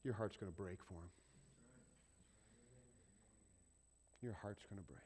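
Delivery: soft, low, heartfelt; tender. Acted. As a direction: let the heart break quietly. Tender reversal after mock cheer.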